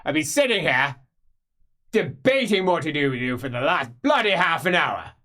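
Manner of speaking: high, loud grumble; grumpy old magister. Acted. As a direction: complain loudly about the boy. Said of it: "He says".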